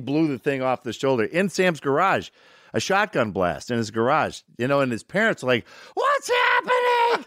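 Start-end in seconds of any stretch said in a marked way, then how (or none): 5.9-7.3 high, drawn-out shout; parents' alarmed yell. Retold or imitated. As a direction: yell like a startled parent from another room. Startled parents yell after shotgun blast.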